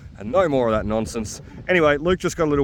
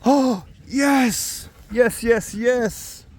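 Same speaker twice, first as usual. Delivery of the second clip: high, thrilled yes yes yes; excited cheering; 11 semitones higher, much slower than usual. Real, not acted. Excited find of silver coin.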